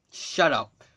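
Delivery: usual speaking voice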